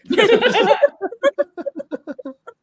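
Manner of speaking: high-pitched peals of laughter; long laugh. Real, not acted. Laughs at the blunt answer.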